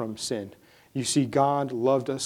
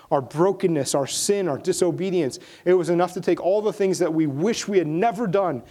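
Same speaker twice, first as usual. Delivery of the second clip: rising, louder preacher's refrain; preaching at full force; 6 semitones higher, 4 dB louder than usual. Acted. Pastor drives home that Christ was enough, preaching louder. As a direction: hammer each "enough" home to the congregation.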